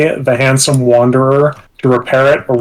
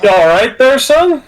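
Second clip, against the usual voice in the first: folksy concern; 10 semitones higher than usual. Acted. Asking the fallen stranger.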